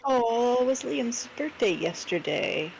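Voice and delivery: usual speaking voice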